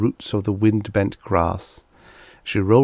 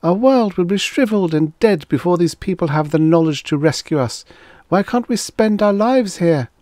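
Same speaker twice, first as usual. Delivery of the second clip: high, loud, plaintive female voice; mournful; 10 semitones higher, 5 dB louder than usual. A narrator's reading. She pleads for her dying world, mournful. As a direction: lament it, pitched high and pleading. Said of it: "she asked mournfully".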